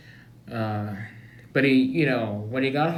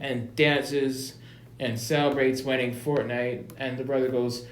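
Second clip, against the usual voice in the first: ordinary delivery, nothing marked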